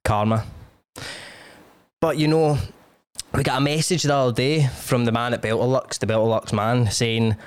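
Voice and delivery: a chatty sponsor read in his own voice; podcast ad read. Acted. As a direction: sell the sponsor like a cheeky mate. Host reads sponsor ads.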